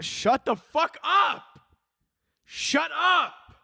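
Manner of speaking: high, drawn-out comic shout; exasperated yell. Acted. Comedian cutting off the Riz hype, hammed-up furious yell.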